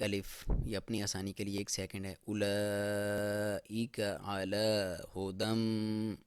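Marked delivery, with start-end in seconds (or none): 2.3-6.2 اولئک علی ھدی chanted slowly, ha clear; slow Quran recitation. Shown as a demonstration. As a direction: recite it long and even. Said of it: "ہا کی آواز clear ہو".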